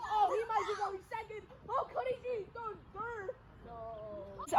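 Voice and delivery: high, excited game commentary; play-by-play. Acted. Announcer tracking a runner round the bases, excited play-by-play. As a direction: follow each twist of the play, voice lifted high.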